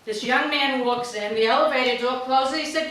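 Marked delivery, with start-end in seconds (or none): none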